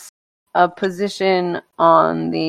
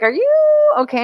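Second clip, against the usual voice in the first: concerned hosts. Retold or imitated. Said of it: "and they're like".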